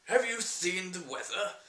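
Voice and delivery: slow, hammed-up posh English; affected British gentleman vlogger; mock posh British. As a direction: send up a teacup-and-pinky Englishman. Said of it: "if every video I was sat here".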